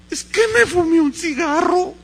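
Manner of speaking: loud, high wail of guilt; hammed-up kid's confession; anguished child confession. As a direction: blurt it out like a tearful boy. Child blurting out his sin.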